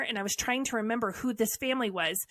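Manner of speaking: ordinary talk, nothing marked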